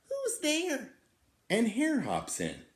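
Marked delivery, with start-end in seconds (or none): 0.1-0.9 very high, squeaky cry; frightened mouse cry. Acted. Mouse calling out to the glowing eyes, squeaky and high. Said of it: "Mouse cries".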